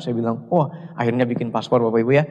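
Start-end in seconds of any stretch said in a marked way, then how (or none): none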